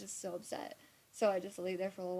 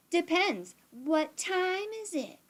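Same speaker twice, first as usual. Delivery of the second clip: much higher, louder, slow and drawn-out cheeky retort; cheeky drunk retort; 11 semitones higher, 9 dB louder, slower than usual. Retold or imitated. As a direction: sass the cop like a tipsy teen. Drunk smart-ass reply to cop.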